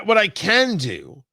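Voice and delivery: ordinary talk, nothing marked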